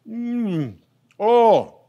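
high pleased mmm and oh; delighted tasting moan. Real, not acted. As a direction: savor it out loud. Tasting the fish.

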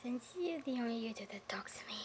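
whispered aside, almost secret. Real, not acted. Telling another YouTuber a secret, whispering. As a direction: whisper it like a shy confession.

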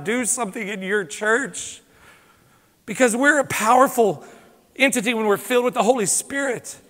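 usual speaking voice

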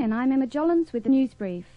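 normal talking voice

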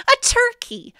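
excited. A narrator's reading. Big reveal of the turkey.

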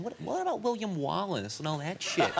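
high, disbelieving American impersonation; American guy. Retold or imitated. American invokes William Wallace to a Scot, high mock-American voice. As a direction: push up high into an indignant American accent. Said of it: "an American guy".

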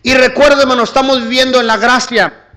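loud, high-pitched sermon delivery; full-force preaching. Real, not acted. Preacher urges the church to announce the Gospel, full-force preaching. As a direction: proclaim it forcefully to the whole church.

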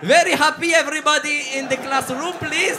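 rally the class like a teacher; English teacher. Pretend. Comedian as English teacher calling the crowd, loud. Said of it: "Je vais passer pour une prof d'anglais".

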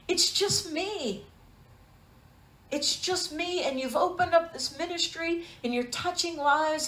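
understated tearful wonder; gently retold mild laugh-cry prayer; between laugh and cry. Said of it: "Somewhere between a laugh and a cry".

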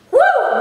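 loud high cheer after finishing; victory whoop. Real, not acted. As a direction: let out a big triumphant woo.

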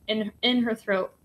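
usual speaking voice

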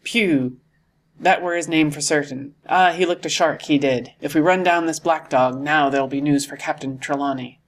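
usual speaking voice

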